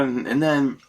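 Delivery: normal talking voice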